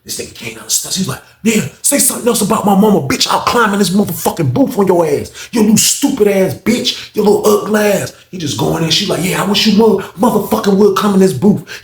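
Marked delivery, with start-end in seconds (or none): 1.4-8.1 high, heated mock threatening; acted angry threat. Inmate threatening the officer in the booth, angry. 9.2-11.8 heated imitated challenge, higher pitch; acted angry dare. Officer daring the inmate to enter, angry.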